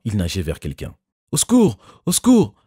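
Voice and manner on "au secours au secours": cry for help. A narrator's reading. Jerry drowning, calling out.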